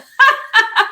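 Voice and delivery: high loud burst of laughter. Real, not acted. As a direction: crack up loudly. Laughing at her own story.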